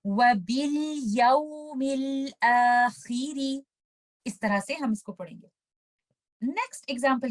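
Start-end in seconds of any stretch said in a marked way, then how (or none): 0.1-3.6 slow Quranic recitation of वबिल यौमिल आखिरी; correct recitation. Shown as a demonstration. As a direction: recite it slowly with clear letters. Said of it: "इसकी correct pronunciation क्या है". (सही तिलावत, धीरे-धीरे)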